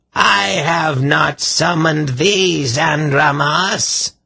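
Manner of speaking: high, slow shrill madman; angry shout. A narrator's reading. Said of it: "Irvan shouted at her".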